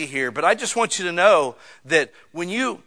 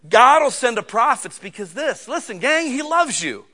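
normal talking voice

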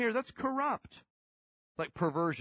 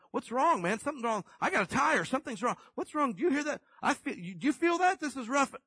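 rising panic, voice climbing high; panicked worry; 11 semitones higher, 4 dB louder than usual. Retold or imitated. Driver on the highway panicking over a rough ride. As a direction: fret aloud like something's breaking.

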